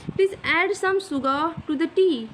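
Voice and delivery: very slow, word-by-word dictation; example sentence read out. Shown as a demonstration. Teacher dictating an English example sentence very slowly. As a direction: spell it out slowly for the class. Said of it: "अब example देख लेते हैं".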